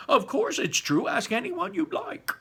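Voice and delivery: high insistent exclaim; cried out. A narrator's reading. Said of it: "cried all four of the old people".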